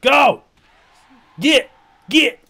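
sharp, high, loud commands; shouting. Real, not acted. Gamer to pestering dinosaur, shouting. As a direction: shout it away in single words.

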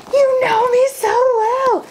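very high squeal, gushing over a gift; squealing gushing girl. Pretend. Pretending a friend gave her the bag, very high squeal. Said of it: "I'd be like".

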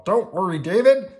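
high, loud, confident puppet voice; Bert. A narrator's reading. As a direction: brush off the worry, loudly and high. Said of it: "said Bert".

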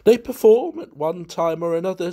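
mildly gleeful society wit; gleeful guest. A narrator's reading. Valentine enjoying his own joke, mildly gleeful. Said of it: "enjoying himself enormously".

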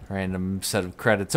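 usual speaking voice

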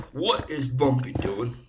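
goofy slow made-up Bumpy accent. Shown as a demonstration. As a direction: put on a silly, drawn-out accent of no known place. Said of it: "I try to do a, an accent".

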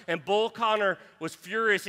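normal talking voice

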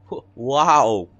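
loud, high shout of wonder; child shouting in awe as the door opens; amazed shout. Acted. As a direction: gasp it out, amazed.

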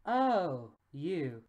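low, falling, unimpressed delivery; dismissive. Acted. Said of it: "She sounds so dismissive".

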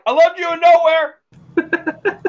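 high, loud, drawn-out fan yell; acted long-distance call. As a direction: shout it after someone nearly out of range. Calling after departing actor.

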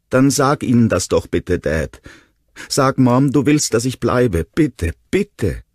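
put-on high little-boy begging; childlike plea, insistent to the last word; pleading child. A narrator's reading. Small boy begs his dad.

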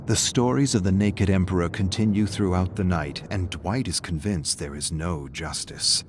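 usual speaking voice

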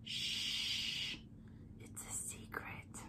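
breathy hushed secret, unvoiced; playful whisper. Beauty vlogger whispering to viewers about the secret palette. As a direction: hush it like sharing a secret.

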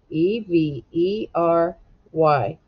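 letters spelled out one by one, slowly. Shown as a demonstration. Teacher spelling the word slowly for the class. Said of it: "spell the word, slow".

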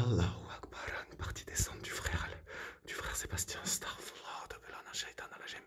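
hushed whisper with invocations; voiceless fearful murmur. Real, not acted. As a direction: whisper the prayers and names. Hushed in a dark house.

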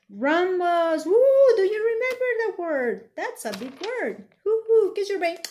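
loud, high, thrilled praise; excited cheer. Real, not acted. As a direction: cheer them on. Teacher cheering kids' answer.

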